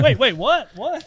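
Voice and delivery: high startled what, wait; mock surprise. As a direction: play a startled listener yelping. Acting surprised listener, door handle.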